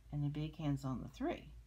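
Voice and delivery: ordinary delivery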